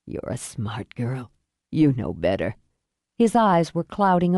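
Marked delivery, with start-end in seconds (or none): 0.1-2.6 very low, fading man's voice; dying father. Acted. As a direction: murmur low as your strength fades.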